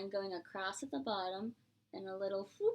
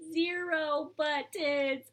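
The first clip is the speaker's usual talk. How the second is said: dramatic reveal; 5 semitones higher, 5 dB louder, slower than usual. Acted. Revealing zero buttons.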